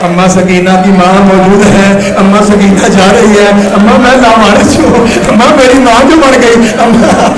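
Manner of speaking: loud, acted wailing plea; weeping lament. Orphaned daughter pleading with her mother, imitated loud weeping. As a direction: cry out as a grieving, motherless daughter. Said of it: "رو رو کے کہتی".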